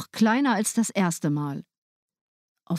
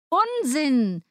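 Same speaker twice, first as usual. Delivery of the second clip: higher, curt scoff; dismissive stepmother; 7 semitones higher than usual. A narrator's reading. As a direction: brush him off with contempt. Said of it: "antwortete die Frau".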